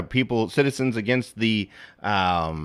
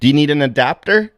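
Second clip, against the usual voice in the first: raised, louder joking dad; mock dad; 6 semitones higher, 5 dB louder than usual. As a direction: deliver the punchline as the dad. Dad joke punchline.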